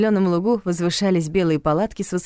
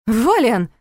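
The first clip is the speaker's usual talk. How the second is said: sharp, louder indignant call of his name; indignant outcry; 5 semitones higher, 5 dB louder than usual. A narrator's reading. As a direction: protest his name, stung. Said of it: "снова возмутилась я".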